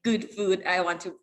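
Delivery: ordinary talk, nothing marked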